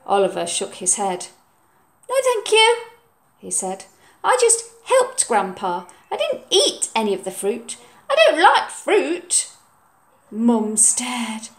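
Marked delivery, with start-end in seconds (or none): 2.1-2.8 put-on high, loud child voice; higher, louder boy; polite refusal. Oliver's voice. 4.2-9.5 put-on boy confessing. Oliver's voice.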